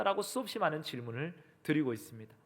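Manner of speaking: usual speaking voice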